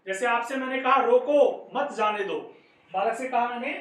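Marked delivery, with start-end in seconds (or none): 1.0-2.4 "रोको" called out loud, then a break; loud "रोको", brief stop after it; pause after रोको. Shown as a demonstration. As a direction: punch "रोको", drop back for the rest. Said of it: "रोको के बाद में यति हुई है".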